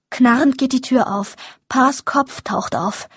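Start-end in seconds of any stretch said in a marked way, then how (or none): none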